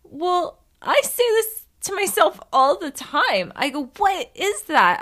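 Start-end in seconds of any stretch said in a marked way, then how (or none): 0.0-3.5 much higher-pitched, eager retelling; retold giddy admission; excited confession. Retells own excited reply to friend. 4.0-5.0 high, loud, drawn-out question; retold incredulous question, pitched way up; eager questioning. Retells own eager questioning.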